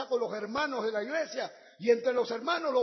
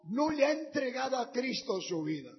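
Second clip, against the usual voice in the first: lower, intimate invitation over music; altar call; 3 semitones lower than usual. Acted. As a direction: call them forward tenderly. Llamado al altar con música. (llamado al altar, íntimo y suplicante)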